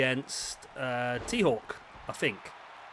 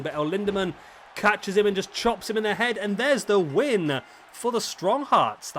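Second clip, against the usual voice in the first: rising to a louder, higher call of the win; excited play-by-play; 6 semitones higher, 5 dB louder than usual. Real, not acted. Commentator calling the winning chop, excited. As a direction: call the finish as it happens. Live finish of the match.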